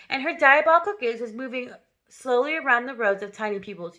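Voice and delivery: usual speaking voice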